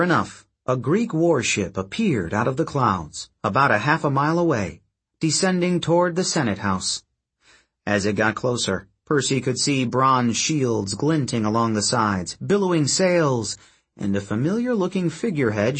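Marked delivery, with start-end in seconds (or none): none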